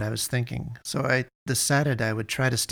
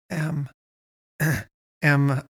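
stuttering; 4 semitones higher than usual. A narrator's reading. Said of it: "I stuttered".

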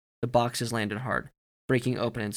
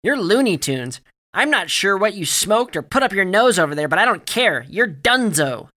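high, loud angry rant; shouted; 8 semitones higher, 10 dB louder than usual. A narrator's reading. Barry fires Alex and throws him out, shouting. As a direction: keep shouting, slowing on the final order. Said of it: "Barry shouted".

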